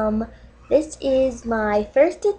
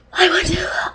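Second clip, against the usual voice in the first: higher, voiceless teary whisper; crying whisper; 5 semitones higher, 4 dB quieter than usual. Acted. Dating video host whispering through mock tears. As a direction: squeak it out through fake tears. Mock sobbing over cats.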